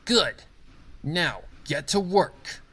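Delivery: sharp, high, slow command. Acted. Boss sending the worker off to work, commanding. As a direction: bark the order down at him. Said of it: "she commanded".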